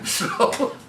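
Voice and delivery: breathless high laughter; real laughter, high and breathless; high wheezing laugh. Real, not acted. Cracks up at story.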